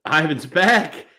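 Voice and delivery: excited announcement. Real, not acted. Hyping a co-host's return.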